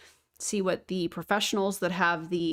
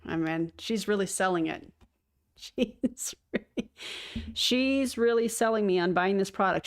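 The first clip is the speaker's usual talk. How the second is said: dry sarcastic praise. Acted. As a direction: say it deadpan, not meaning it. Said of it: "That's sarcasm".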